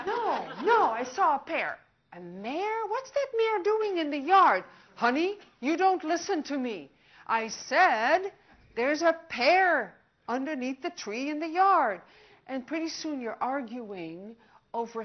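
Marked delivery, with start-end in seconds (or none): 0.1-1.8 loud, higher, insisting no; wife correcting her husband, loud and exasperated; exasperated correction. Acted. Acted couple quarrel. 2.1-4.6 much higher and louder incredulous cry; husband bewildered by the word, loud incredulous cry; baffled outburst. Acted. Acted couple quarrel. 4.9-12.0 loud, higher complaint at a spouse; wife scolding her husband, loud and frustrated. Acted. Acted couple quarrel.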